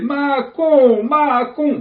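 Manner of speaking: high, loud hammed-up Italian cook shouting Macon; Italian chef accent. Chef bursting from the kitchen, loud Italian accent. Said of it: "Bon, il est italien".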